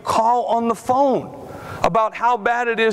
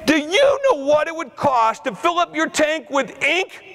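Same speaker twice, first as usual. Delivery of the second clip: challenging shout; 6 semitones higher than usual. Real, not acted. Challenging the audience.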